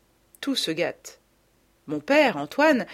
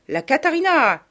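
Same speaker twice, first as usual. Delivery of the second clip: higher, louder festive cry; excited cry; 8 semitones higher, 6 dB louder than usual. A narrator's reading. Mother bursting into the salon, excited shout. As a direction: announce yourself with gusto. Mother whirls onto the floor.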